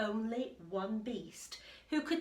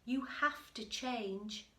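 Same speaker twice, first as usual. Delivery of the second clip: lively read-aloud voice, a little louder; story narration; 4 semitones higher, 8 dB louder than usual. A narrator's reading. Teacher reading the picture book to the class, lively storytelling. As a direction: read it to the children with bounce.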